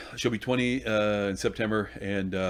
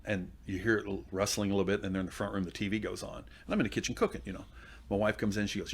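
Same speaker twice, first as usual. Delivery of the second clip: ordinary talk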